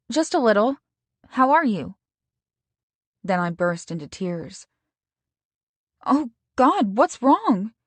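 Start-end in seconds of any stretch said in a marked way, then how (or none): 0.1-1.9 higher, lightly voiced best friend; a cheerful friend picking up the phone. Best friend answering Stacy's call, higher and cheerful. 6.1-7.7 higher, louder alarmed friend; worried friend. Acted. Best friend hearing Stacy sob, higher and alarmed. Friend hears her crying.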